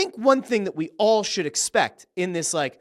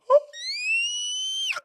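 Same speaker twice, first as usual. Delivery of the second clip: sharp shocked intake of breath, played big; huge mock gasp; 3 dB quieter than usual. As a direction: gasp like the crowd just lost it. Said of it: "And you go".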